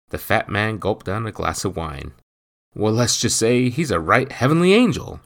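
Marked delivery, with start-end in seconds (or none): 2.8-5.2 eager, louder portly gossip; blurting fat man. A narrator's reading. Adelson gossips.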